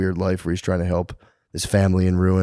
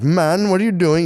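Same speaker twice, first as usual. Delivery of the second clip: higher, pushy wife's complaint; mock wife voice; 10 semitones higher, 4 dB louder than usual. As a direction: play the wife nagging her husband. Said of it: "She'd be like".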